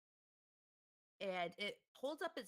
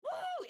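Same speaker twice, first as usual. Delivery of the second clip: very high loud owl woo; high owl cry; 8 dB louder than usual. Acted. GM voices the owl.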